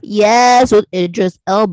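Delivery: loud, delighted yes after a gasp; thrilled and emphatic; real excitement. Real, not acted. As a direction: gush loudly about a favorite actor. Thrilled friend started watching Luther.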